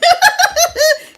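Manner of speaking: a sudden high real laugh. Real, not acted. Reader laughing at her own punchline, high laugh.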